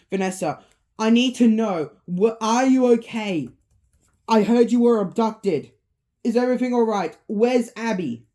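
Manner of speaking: high, loud anxious questions; worried. Acted. Mike phones Vanessa about missing Abby, worried and loud. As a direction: fire off alarmed questions. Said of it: "He starts to worry".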